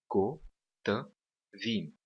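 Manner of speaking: "Ko, ta, vim" one beat at a time; three separate syllables. Shown as a demonstration. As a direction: sound out "Ko, ta, vim" with a pause after each syllable. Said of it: "está dividida em três sílabas".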